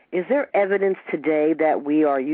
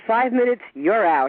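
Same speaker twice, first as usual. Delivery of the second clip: Five minutes, you're out said louder and higher so the guest can hear; raised voice repeating a joke nobody caught; 5 semitones higher, 4 dB louder than usual. Real, not acted. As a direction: call it again over the line, louder. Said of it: "What, what's that".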